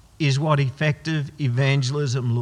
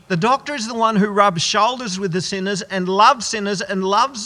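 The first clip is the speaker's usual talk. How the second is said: rising, louder preacher's build; impassioned preaching; 5 semitones higher, 4 dB louder than usual. Acted. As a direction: preach it with growing fire. Preaching climax.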